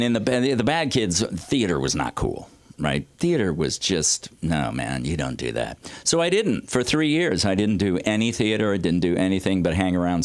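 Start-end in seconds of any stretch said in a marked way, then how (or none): 4.4-5.8 bad kid scoff. Bad kids dismissing theater.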